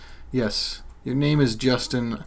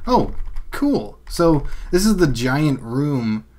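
ordinary talk

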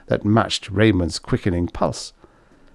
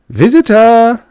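high loud recorded chime; gleeful door announcer; 14 semitones higher, 9 dB louder, slower than usual. A narrator's reading. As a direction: crow it out like a gleeful doorbell. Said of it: "cried again in its one-word recorded glee".

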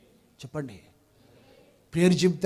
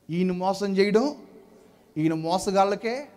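ordinary talk, nothing marked

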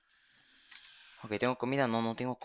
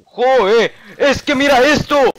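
high panicked scream, much louder; 14 semitones higher, 16 dB louder, slower than usual. Real, not acted. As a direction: shriek in panic. Panicked scream at attacking mobs.

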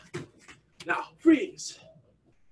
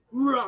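higher-pitched, drawn-out tiger growl; tiger roar; 6 semitones higher than usual. Shown as a demonstration. Coach finishing the push-up with Millie, tiger roar. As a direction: roar as you come up. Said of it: "we're gonna roar".

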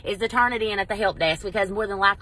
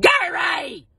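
loud high call across a lot; 11 semitones higher, 5 dB louder than usual. Retold or imitated. Wife calling husband back in the rain, loud. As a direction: yell his name to stop him.

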